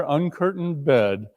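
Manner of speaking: ordinary delivery, nothing marked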